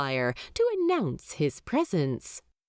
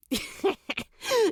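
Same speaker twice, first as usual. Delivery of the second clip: snigger. Acted.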